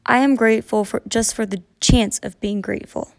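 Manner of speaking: ordinary delivery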